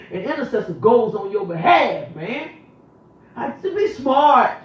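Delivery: loud high sermon peak; preaching at full force. Real, not acted. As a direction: drive it up to a shouted climax. Preaching climax.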